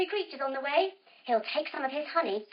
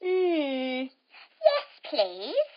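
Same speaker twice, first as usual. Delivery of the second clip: bird voice; much slower than usual. Acted. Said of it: "The bird looks at Enzo and says".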